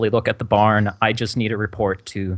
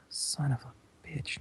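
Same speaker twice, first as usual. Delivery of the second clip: heavy sigh into a whispered curse; big sigh; 3 semitones higher, 16 dB quieter, slower than usual. Acted. Inspector hearing what was left out, big exasperated sigh. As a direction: sigh it out, swearing under your breath. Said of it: "let out a big sigh and go".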